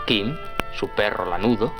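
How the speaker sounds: ordinary delivery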